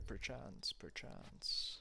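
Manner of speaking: breathy playful whisper. Real, not acted. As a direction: whisper it teasingly. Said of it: "playful whisper to a viewer".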